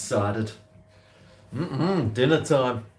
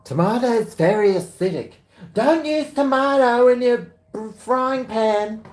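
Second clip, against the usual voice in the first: much higher, louder mock nagging voice; squeaky sing-song impression of know-it-alls; shrill-free mock scold, high and loud; mocking the critics; 13 semitones higher, 6 dB louder than usual. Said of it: "When I hear people say that".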